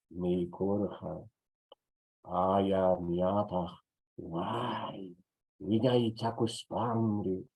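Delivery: teacher reciting a Quechua poem to the class; poem recital. Acted. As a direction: declaim the Quechua verse with feeling.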